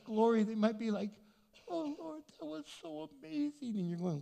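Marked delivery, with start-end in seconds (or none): 1.7-3.8 gushing heavenly delight. Retold or imitated. Saved person gushing in glory.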